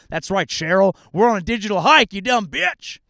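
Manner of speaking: high, loud mock yell at Cheryl; screaming rant. Host yelling at Cheryl from HR, loud mock screaming. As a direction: blow up at an imaginary coworker. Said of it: "I shouldn't scream".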